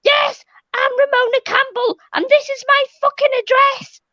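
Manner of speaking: high shouted announcement; mocking Mona screaming at the party guests. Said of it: "screamed at everyone".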